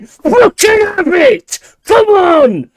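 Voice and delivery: high, drawn-out roar of celebration; real explosive fan jubilation; ecstatic goal shout. Real, not acted. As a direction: scream it as your team scores.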